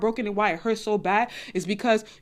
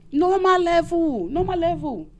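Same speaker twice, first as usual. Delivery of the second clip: normal level; 6 semitones higher, 3 dB louder, slower than usual. Shown as a demonstration. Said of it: "demonstrates level voice".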